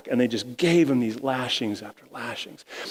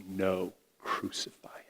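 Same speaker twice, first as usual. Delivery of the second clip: whispered crowd shout; 4 semitones lower, 11 dB quieter than usual. Acted. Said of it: "the crowd shouts".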